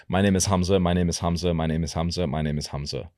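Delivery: quick, run-on everyday delivery; normal fast pace. Shown as a demonstration. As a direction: rattle it off quickly. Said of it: "I'm quite fast".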